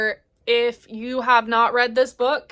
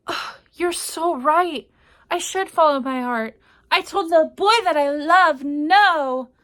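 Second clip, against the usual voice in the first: milk every word like a soap-opera lead; hammed-up dramatic; 6 semitones higher, 5 dB louder than usual. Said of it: "she's being all dramatic".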